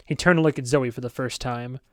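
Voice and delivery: ordinary delivery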